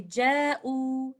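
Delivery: ja'u recited slowly with the waw mad held two counts; slow tajweed recitation of ja'u; recited Quranic word. Shown as a demonstration. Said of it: "So we will say".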